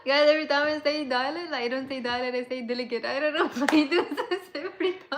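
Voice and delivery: real embarrassed laughter while talking; laughing through words. Real, not acted. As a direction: giggle through the whole admission. Laughing at own word slip.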